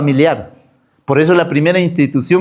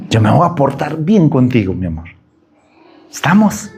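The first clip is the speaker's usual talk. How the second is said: coaxing wife; 7 semitones lower than usual. Retold or imitated. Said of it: "cómo lo vas a convencer".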